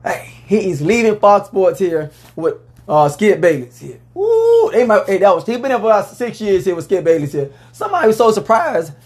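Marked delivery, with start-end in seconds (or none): 4.1-4.7 high, loud excited whoop. Real, not acted. Host reacting to the news with a high whoop. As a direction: let out a big hype cry.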